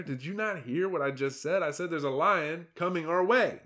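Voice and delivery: a fretful zebra pleading to be heard; exasperated herd lookout; alarmed zebra. Acted. Hector warns the herd.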